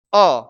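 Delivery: अ as a short, falling "uh"; correct vowel model. Shown as a demonstration. Teacher giving the true sound, emphatic short vowel. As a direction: say अ alone and firmly, mouth barely open. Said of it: "इसकी ध्वनि है".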